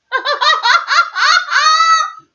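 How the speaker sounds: a loud, high burst of real laughter. Real, not acted. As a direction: laugh out loud at your own joke. Laughs at own joke.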